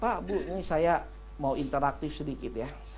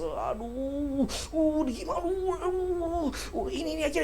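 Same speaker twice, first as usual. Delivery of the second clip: panicky person; 10 semitones higher, 3 dB quieter than usual. Acted. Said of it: "temen-temen yang nggak tenang".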